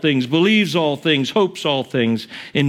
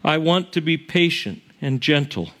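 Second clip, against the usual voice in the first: hymn recitation; 3 semitones lower than usual. Acted.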